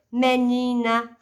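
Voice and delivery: "Menina" slowly, holding "ni"; stress on penultimate syllable. Shown as a demonstration. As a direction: lean on the "ni" in "Menina". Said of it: "penúltima". ("Menina" devagar, alongando o "ni")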